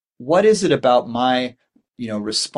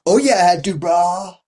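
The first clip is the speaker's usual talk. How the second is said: dude attitude; 6 semitones higher, 5 dB louder than usual. Retold or imitated. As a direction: ham up a playground bro voice. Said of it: "particular sort of dude attitude".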